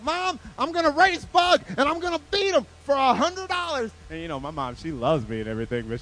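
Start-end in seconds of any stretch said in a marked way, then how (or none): 0.0-3.9 high, loud excited announcement; acted childlike excitement; boasting kid. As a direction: play an eager boy bragging to mom. Boy boasts to his mom.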